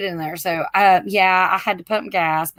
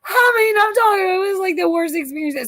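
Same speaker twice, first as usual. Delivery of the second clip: high, strained, teary emphasis; near-crying exasperation; 9 semitones higher than usual. Real, not acted. Driver telling friends about the worst trip, near tears. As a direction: pitch it up like it still hurts.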